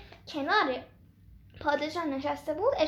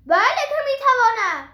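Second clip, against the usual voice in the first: boastful giant yelling, much louder and higher; proud shout; 8 semitones higher, 12 dB louder than usual. Acted. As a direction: roar it out full of pride. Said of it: "با غرور فریاد زد".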